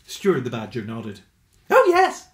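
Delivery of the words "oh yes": high, loud cheerful squeak. A narrator's reading. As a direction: chirp it out happily. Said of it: "he said cheerfully".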